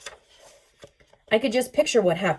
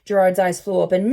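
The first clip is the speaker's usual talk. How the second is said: usual speaking voice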